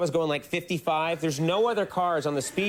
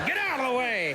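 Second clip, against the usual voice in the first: a higher, hammed-up road-hog snap; mock impatient driver; 8 semitones higher than usual. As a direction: play the pushy driver elbowing past, half joking. Mock driver shoving old lady.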